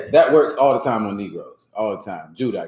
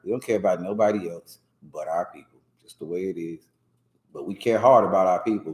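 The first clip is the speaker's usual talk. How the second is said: ordinary talk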